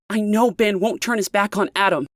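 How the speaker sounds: demanding. A narrator's reading. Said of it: "Leah demanded".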